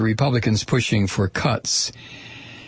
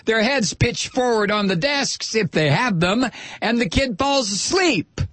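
impassioned; 9 semitones higher than usual. Real, not acted. Impassioned rant on hungry children.

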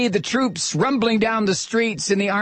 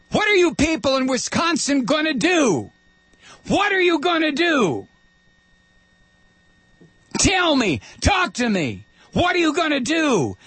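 shouting; 7 semitones higher than usual. Real, not acted. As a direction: yell it at them, demanding an answer. Host berates Wisconsin listeners.

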